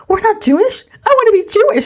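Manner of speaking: high-pitched, dismayed little boy, retold; upset child. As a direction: imitate a child about to cry in protest. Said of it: "he starts crying in church".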